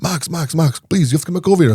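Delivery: excited client on the phone, imitated; imitated excitement. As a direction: act out an excited caller begging. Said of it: "He's all excited".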